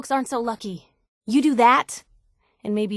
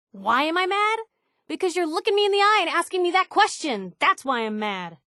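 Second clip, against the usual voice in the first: angry; 7 semitones higher than usual. Acted. Confronting friend, angry.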